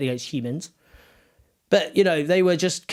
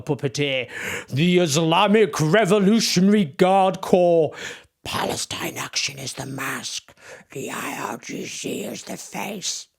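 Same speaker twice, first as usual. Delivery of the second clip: slow sinister voice mocking the lobby group's dramatic tweet; sinister villain voice; slower than usual. Shown as a demonstration. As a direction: read it like a sinister movie trailer. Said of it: "Apologies there for the voice".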